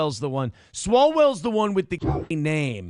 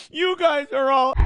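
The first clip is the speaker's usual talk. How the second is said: mocking whine; 10 semitones higher, 4 dB louder than usual. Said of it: "and then you go".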